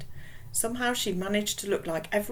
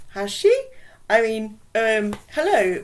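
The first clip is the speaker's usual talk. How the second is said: flustered surprise; 4 semitones higher, 9 dB louder than usual. Acted. Aidan caught off guard.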